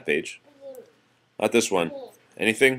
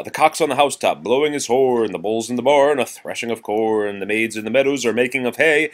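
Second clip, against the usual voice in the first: raised, lively nursery rhyme reading; reading to children; 5 semitones higher, 6 dB louder than usual. Acted. Parent reading nursery rhymes to child, lively. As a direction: read it out brightly for a child.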